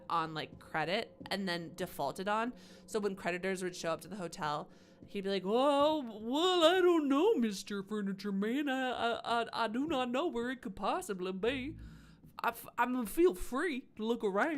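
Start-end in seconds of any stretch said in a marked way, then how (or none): 5.4-14.6 higher, louder put-on accent, stammering and sly; H H Holmes's accent. Killer fobbing off the furniture man in a put-on accent. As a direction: play dumb in a put-on accent. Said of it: "I've given H H Holmes his accent".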